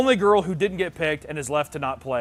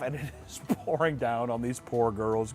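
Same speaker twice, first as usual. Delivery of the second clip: usual speaking voice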